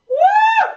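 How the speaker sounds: a high, loud cheering whoop; excited whoop. Real, not acted. Instructor whooping at the class mid-set, loud and high.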